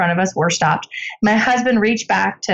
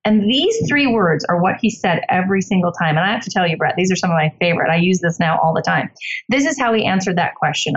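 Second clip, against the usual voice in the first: ordinary talk, nothing marked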